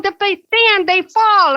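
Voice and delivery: high, loud nurse warning; high loud scolding nurse; urgent warning. Retold or imitated. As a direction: warn sharply of danger.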